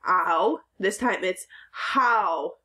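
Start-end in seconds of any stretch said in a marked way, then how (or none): 0.1-0.6 "Ow" said as a clear ow vowel; ow sound. Shown as a demonstration. Teacher modeling the ow sound for "how", clear ow. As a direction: say the ow sound plainly. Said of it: "W saying".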